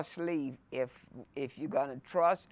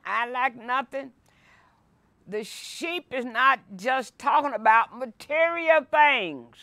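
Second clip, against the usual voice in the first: higher, louder preacher's voice, building fervor; fervent preaching; 7 semitones higher, 7 dB louder than usual. Acted. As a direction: preach it out to the congregation. Preaches the psalm at full force.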